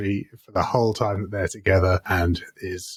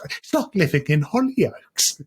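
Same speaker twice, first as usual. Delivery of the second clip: slow, higher dramatic Essex drawl; imitating Pam; 6 semitones higher than usual. Podcaster quoting Pam's dramatic complaint, slow, higher and theatrical. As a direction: moan it like a theatrical mum. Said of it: "She's like".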